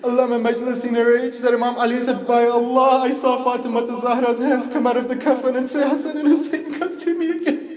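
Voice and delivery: high, strained elegy chant of grief; keen the tragedy as a mourning recitation; mourning lament. Acted. Preacher reciting Fatima's death to mourners, high grieving lament.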